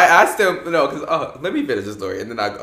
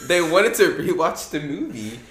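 ordinary talk

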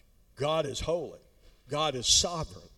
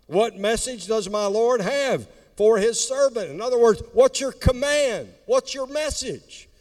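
booming motivational speech; 7 semitones higher, 5 dB louder than usual. Acted. Preaching at full force.

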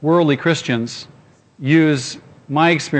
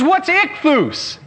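much higher, slow, puzzled question; confused girl; 13 semitones higher, much slower than usual. Retold or imitated. Girl puzzled by his answer, slow and high. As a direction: stretch it out, baffled.

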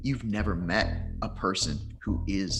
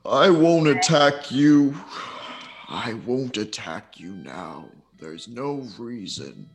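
unearthly voice. Acted. GM voices the lion spirit.

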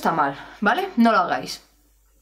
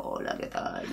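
creaky, very low and soft; vocal fry; 13 dB quieter than usual. Shown as a demonstration. Singing teacher greeting in vocal fry as an exercise. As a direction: greet someone in pure fry. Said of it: "Intentad hablar con este sonido".

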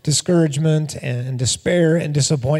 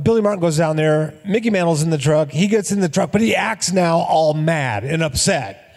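ordinary talk

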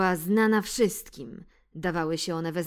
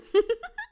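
high gleeful giggle; 7 dB louder than usual. Acted. Mouse laughs at muddy cat.

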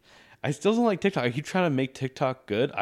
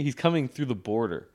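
ordinary delivery

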